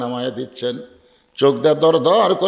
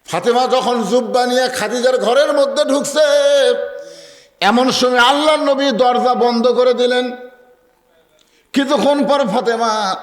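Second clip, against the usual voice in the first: high-pitched, ringing sermon storytelling; impassioned preaching; 10 semitones higher, 5 dB louder than usual. Acted. (উঁচু সুরে আবেগভরা ওয়াজ)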